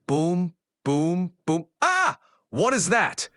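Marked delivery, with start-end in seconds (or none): 1.8-2.2 sudden high yelp of fright; startled scream. Acted. Mock shriek at a ghost popping out of a cookie.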